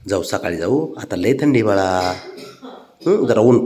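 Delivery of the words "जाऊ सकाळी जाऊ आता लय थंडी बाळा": soft soothing. Real, not acted. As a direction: gently soothe the little one. Soothing child to wait. (बाळाला हळू आवाजात समजावणे)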